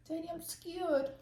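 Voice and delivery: put-on a small frightened child asking Daddy; child's voice. Daughter to dad in the fort, mock scared child voice. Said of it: "She goes".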